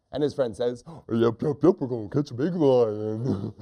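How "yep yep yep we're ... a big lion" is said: goofy dim-witted echo; silly hunter. Acted. Silly hunter repeating his friend, goofy eager voice. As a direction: parrot it like a silly sidekick. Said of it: "He's pretty silly".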